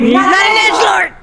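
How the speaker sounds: indignant shout. Real, not acted. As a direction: shout it in playful outrage. Fighting over Mephiles.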